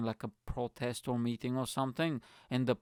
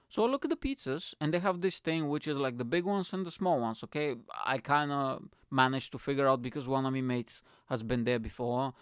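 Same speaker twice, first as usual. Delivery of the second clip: ordinary talk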